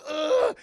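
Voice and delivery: loud high effort grunt. Retold or imitated. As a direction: heave it out with a grunt. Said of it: "He's like".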